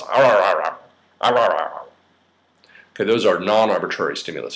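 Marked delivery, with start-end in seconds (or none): 0.0-1.8 mimicked R sounds. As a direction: run of repeated "ar" noises. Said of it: "you might say, ... It goes".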